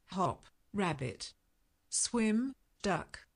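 usual speaking voice

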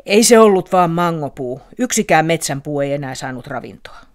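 irritated springtail scolding the curious owl; grumpy springtail. A narrator's reading. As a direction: snap back like a grumpy little bug. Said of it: "Äkäinen hyppyhäntäinen huokasi ja sanoi".

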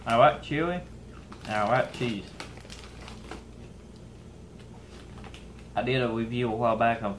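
talking with food in mouth; chewing chips. Real, not acted. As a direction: mouth partly full. Seen 0: hand to mouth, chip bag in hand throughout.

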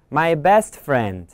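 plain reading voice

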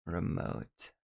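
normal talking voice

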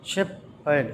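slow "shepherd" in two separate beats; syllable by syllable. Shown as a demonstration. Teacher counting syllables for children, "shepherd" slowly split in two. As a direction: break "shepherd" into shep and herd with a pause. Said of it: "two syllables".

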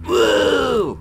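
loud whoop of joy; excited shout. Acted. Cheering on arriving home.

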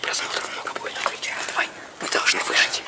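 excited unvoiced whisper; whispering while hiding. Real, not acted. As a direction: whisper it like a secret. (азартный шёпот)

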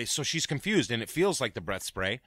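usual speaking voice

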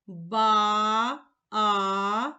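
Ба, then a long drawn-out а; stretched sound. Shown as a demonstration. Teacher to pupils, isolating each sound: а drawn out. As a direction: stretch the vowel а after Ба. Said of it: "позначаю однією рисочкою".

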